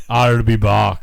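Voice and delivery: mock Austrian action-hero voice; put-on catchphrase delivery; Arnold Schwarzenegger. Shown as a demonstration. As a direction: do the movie tough guy's Austrian accent. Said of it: "Arnold Schwarzenegger".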